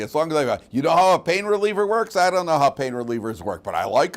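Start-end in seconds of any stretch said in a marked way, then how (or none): none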